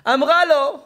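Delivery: high, booming preacher's cry; sudden full-volume outburst; dramatic shout. Real, not acted. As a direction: hurl the words at the audience. Preacher shouts story turn.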